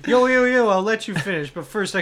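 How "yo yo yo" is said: loud hype intro; hype shout. Pretend. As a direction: shout it like a rapper taking the mic. [name] interruption parody.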